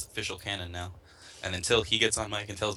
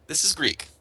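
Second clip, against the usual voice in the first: higher and louder, hammering that it's Greek; exasperated insistence; 9 semitones higher, 5 dB louder than usual. Retold or imitated. Replaying his own reply to strangers, exasperated and loud. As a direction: set them straight, fed up.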